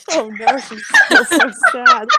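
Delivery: high, loud mock pity through laughter; laughing sympathy. Real, not acted. Players reacting to an offended character, laughing. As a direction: cry "aw" through a laughing fit.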